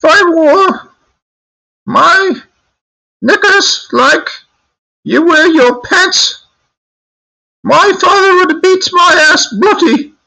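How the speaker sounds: squealing, very high British granny rant; British grandma. Retold or imitated. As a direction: scold as a hammed-up falsetto British grandmother. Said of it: "my grandma used to always talk".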